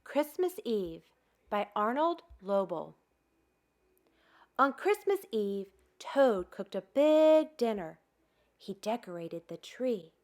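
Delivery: usual speaking voice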